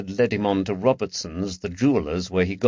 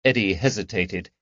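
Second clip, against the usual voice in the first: plain reading voice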